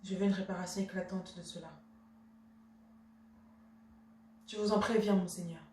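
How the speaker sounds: soft, low, controlled reproach; restrained indignation. Acted. Wife demanding justice from her husband, restrained indignation. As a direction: hold the offence in, keep it quiet.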